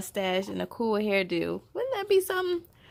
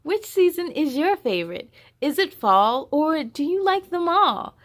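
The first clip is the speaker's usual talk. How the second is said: bright, louder storybook voice with higher lines; reading to children; 6 semitones higher, 7 dB louder than usual. Acted. Host reading the picture book aloud to young viewers. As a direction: read it out big and lively for little ones.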